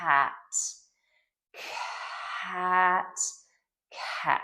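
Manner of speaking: "cat" with a long breathy burst after the k; aspirated cat. Shown as a demonstration. Therapist modeling "cat" for a child, airy to quick. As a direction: stretch the air of "cat", then speed up. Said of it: "So it would be".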